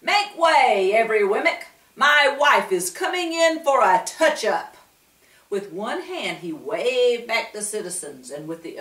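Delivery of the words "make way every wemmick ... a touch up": loud, high public order; mayor clearing way for his wife, loud; mayor's loud call. A narrator's reading. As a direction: command the crowd to part.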